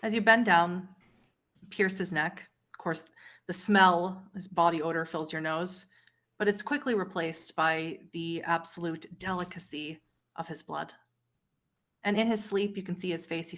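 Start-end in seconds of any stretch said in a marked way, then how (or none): none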